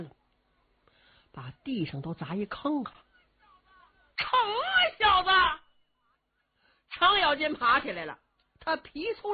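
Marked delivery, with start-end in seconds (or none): 4.2-5.3 brash exclamation. Acted. Cheng Yaojin hit, exclaims.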